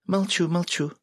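very high, playful female voice; tipsy woman act. A narrator's reading. Joker playing drunk Sveta when shushed, tipsy. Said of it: "Балагур пьяно".

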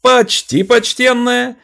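very high, loud, slow yell; yelling at the top of lungs. Acted. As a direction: bellow it to the crowd. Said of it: "Вопю во всё горло".

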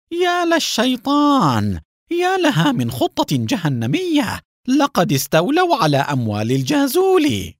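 high, aghast exclamations; appalled realisation, voice soaring in disbelief; stunned. A narrator's reading. Haim grasping the whole scheme, stunned and aghast. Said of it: "وتمتم حاييم بذهول".

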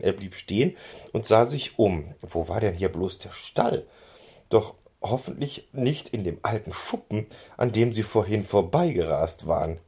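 usual speaking voice

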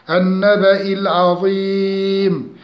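العظيم with a drawn-out final long i; level chanted tone, long vowel in العظيم; tajweed recitation. Shown as a demonstration. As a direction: chant النبأ العظيم, stretching the end of العظيم. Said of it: "عندي هنا مد عارض للسكون".

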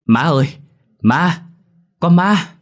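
loud, high shriek of fright, rising each time; startled old ghost yelling in alarm, high and loud; panicked cry. A narrator's reading. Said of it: "hốt hoảng kêu lên".

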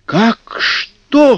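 high shocked gasp; gasping surprise. Acted. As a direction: gasp in disbelief. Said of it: "хором ахнули".

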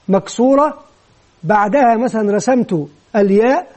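ordinary talk

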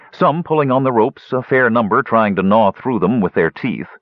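ordinary delivery, nothing marked

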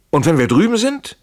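high, loud rising question; urgent challenge. A narrator's reading. Fugitive asks what happens beyond the fence, urgent challenge. Heated exchange during the escape.

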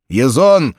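loud call across the room; shouted. A narrator's reading. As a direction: shout for Ezon. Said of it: "крикнул астрогатор".

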